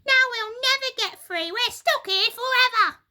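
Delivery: high, loud, fast wailing; despairing wail. Acted. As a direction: panic at being stuck. Missus Twit wail.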